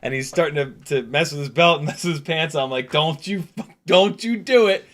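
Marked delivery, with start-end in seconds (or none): 2.9-4.8 higher-pitched warning, held short of a scream; "don't you dare" warning, not yet screamed; mock warning. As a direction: warn him off in a rising voice. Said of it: "And I actually screamed up there".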